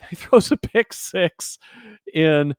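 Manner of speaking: laughing it out, high and slow. Real, not acted. Host laughing in disbelief at the quarterback's pick six, laughing.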